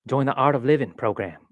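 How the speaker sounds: put-on a loose, half-hearted stab at a celebrity accent; Matthew McConaughey. Vlogger mocking an Art of Living ad, bad celebrity accent. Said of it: "Matthew McConaughey".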